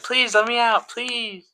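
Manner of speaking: high, soft little plea to be let out; tiny high-pitched voice begging softly; pleading little guy. Acted. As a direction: beg like a little trapped creature, high and quiet. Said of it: "And he's like".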